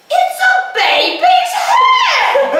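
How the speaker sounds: put-on very high, loud, slow shout of a child; the little boy announcing the baby's head, shouted high and slow; excited child shout. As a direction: cry it out like the amazed boy. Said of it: "her son is going".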